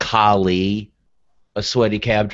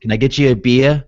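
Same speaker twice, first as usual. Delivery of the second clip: Boston mom imitation; 5 semitones higher, 6 dB louder than usual. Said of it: "my mom sometimes will say".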